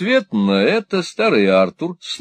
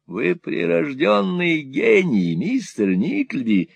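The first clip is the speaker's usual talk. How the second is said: put-on high, wheedling old miser voice; fawning old moneylender; 9 semitones higher, slower than usual. Old usurer fawning on Ralph, high and wheedling. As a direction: simper it like a cringing usurer. Gride voiced in Nickleby reading.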